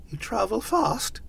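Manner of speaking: high, small character voice; timid Scrooge. A narrator's reading. Scrooge questioning Jacob's ghost, timid. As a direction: venture it meekly, pitched high.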